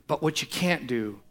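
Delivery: ordinary talk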